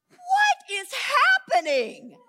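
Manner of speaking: slow, high, loud exclamation; baffled outcry. Retold or imitated. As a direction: wail it in exaggerated bewilderment. Acts out baffled thought to herself.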